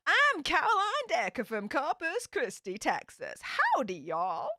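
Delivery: hammed-up Texan accent, pitch swooping high then dropping; attempted American accent. Shown as a demonstration. Vlogger plays Annie faking a Texan identity, attempted American accent. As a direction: put on an amateur Texas twang, starting high. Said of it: "this is [name]'s American accent".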